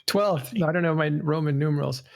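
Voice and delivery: normal talking voice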